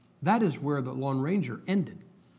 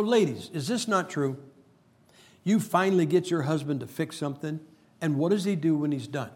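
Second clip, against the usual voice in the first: normal talking voice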